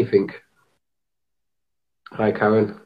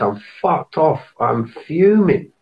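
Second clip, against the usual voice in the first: angry; 5 semitones higher, slower than usual. Real, not acted. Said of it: "I'm fucking angry".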